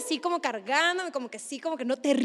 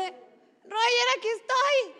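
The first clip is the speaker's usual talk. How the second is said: llamando de lejos con voz aguda; llamado imitado; 9 semitones higher, much slower than usual. Retold or imitated. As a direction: imita un llamado agudo para que te vean. (llamando a alguien, en voz aguda)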